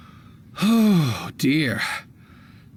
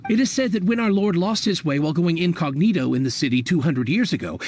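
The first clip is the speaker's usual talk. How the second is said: ordinary talk, nothing marked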